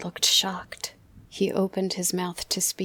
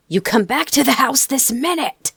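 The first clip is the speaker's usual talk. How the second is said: higher, much louder furious shout; full shout; 7 semitones higher, 10 dB louder than usual. Acted. Father ordering his son back into the house, full shout. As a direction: bellow it with all you've got. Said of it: "shouted my father at the top of his voice".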